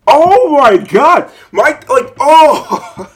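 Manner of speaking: high, loud, slow disbelief; exasperated. Real, not acted. Reacting to the paperwork mix-up, loud and exasperated. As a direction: groan it out, half laughing. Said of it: "I have not been this mad".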